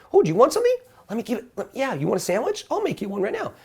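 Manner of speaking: high, eager, generous offer; eager flight attendant. Retold or imitated. Flight attendant offering the passenger a sandwich, high and eager.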